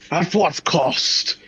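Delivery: high, slow, grand delivery; dramatic declaration. Acted. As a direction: proclaim it like an epic hero. Dramatic repeated line.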